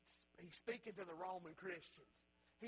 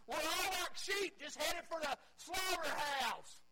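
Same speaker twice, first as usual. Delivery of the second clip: preaching at full force; 6 semitones higher, 6 dB louder than usual. Acted. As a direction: hammer home the sheep image. Impassioned preaching about sheep.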